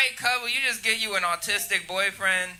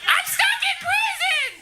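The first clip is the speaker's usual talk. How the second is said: put-on very high, slow, laughing baby wail; mock newborn scream. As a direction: shriek it like a squalling newborn. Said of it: "came out the pussy screaming".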